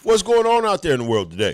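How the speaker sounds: big high hype call out to the world; loud, high hype welcome; hype greeting. Real, not acted. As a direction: pump up the listeners. Host greeting audience with hype.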